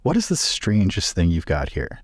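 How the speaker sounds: normal talking voice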